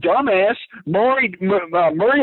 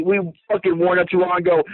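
ordinary talk

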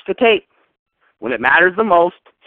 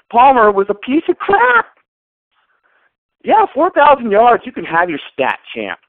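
higher and louder heated rant; angry rant; 8 semitones higher, 3 dB louder than usual. Real, not acted. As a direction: let the anger boil over. Ranting about Palmer's stats.

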